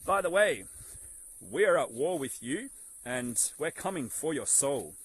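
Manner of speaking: high, loud breezy mock greeting; mock announcement. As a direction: ham up a cheery villain's confession. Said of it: "can't come out right now and be like".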